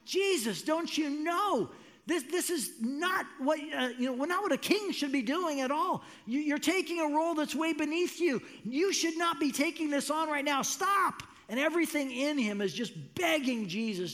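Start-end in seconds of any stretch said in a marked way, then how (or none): 0.0-11.2 higher and rising into a sharp "Stop"; pleading protest. Retold or imitated. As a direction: Peter begging Jesus not to kneel, climbing in pitch. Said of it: "Peter's like".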